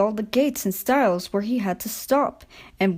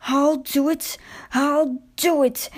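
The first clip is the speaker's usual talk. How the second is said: gasping; 6 semitones higher than usual. A narrator's reading. Exhausted Skarloey nearing the station, gasping higher. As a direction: gasp it out as you struggle on. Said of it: "he gasped between the clangs".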